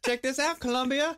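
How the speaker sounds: high-pitched playful rapper impression; comic impression of a teasing rapper. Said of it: "He's like".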